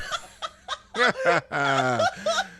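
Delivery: bursting into laughter; laughing. Real, not acted. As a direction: breaks up laughing. Laughs after the skit.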